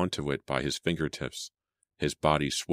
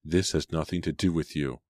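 usual speaking voice

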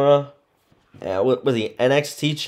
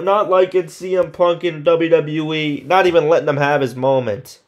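ordinary talk

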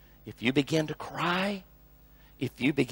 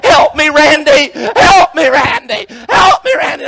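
loud, high tearful wail for help; hammed-up sobbing shout; imitated crying plea; 12 semitones higher, 18 dB louder than usual. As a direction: wail it like a man bawling for rescue. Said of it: "and he's crying".

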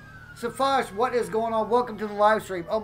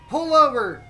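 higher and loud police command; commanding shout; 6 semitones higher, 8 dB louder than usual. Acted. Cop shouting at the fleeing driver, commanding. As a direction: bark the order at the fleeing driver.

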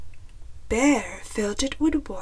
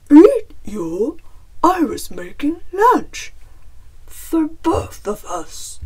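loud, astonished bear voice; explaining bear; 4 semitones higher, 8 dB louder than usual. A narrator's reading. Bear explains lunch to Chicken, loud surprised bear. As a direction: protest loudly as a misunderstood bear. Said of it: "Bear explained".

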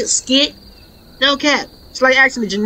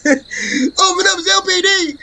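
laughing cop impression; 6 semitones higher than usual. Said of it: "The cops".

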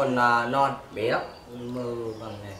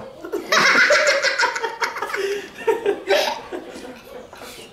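high giggle; 7 dB louder than usual. Real, not acted. Laughing while eating.

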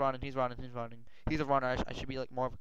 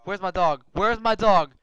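frantic shout; 6 semitones higher, 15 dB louder than usual. Real, not acted. Dog missing after attack.

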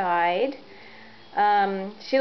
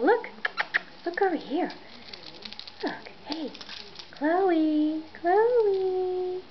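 high, coaxing call to a pet; 8 semitones higher, slower than usual. Real, not acted. Owner calling Chloe over in a high coaxing voice. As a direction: call the cat sweetly, up high.

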